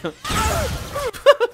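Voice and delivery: shrill high burst of laughter; real high laugh. Real, not acted. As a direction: cackle at the absurdity. Laughs at fanfiction.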